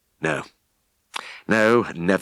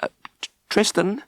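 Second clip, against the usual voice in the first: high, nervous stammer; nervous stammering answer; shaky. A narrator's reading. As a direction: stammer the name, rattled. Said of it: "I answered shakily".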